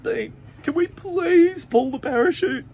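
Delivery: high, loud, slow begging; desperate plea. Acted. Pete begs Bean to pull the parachute, high and loud. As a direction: beg your friend, terrified.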